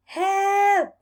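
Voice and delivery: high loud cry for help. A narrator's reading. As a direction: yell for help as he rolls. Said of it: "he cried".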